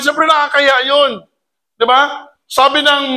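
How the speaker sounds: usual speaking voice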